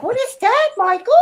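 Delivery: high-pitched innocent questioning, playfully imitated; naive Mantis. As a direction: put on a very high, wide-eyed ingénue voice. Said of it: "her naivete is fantastic".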